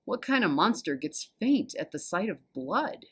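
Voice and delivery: ordinary delivery, nothing marked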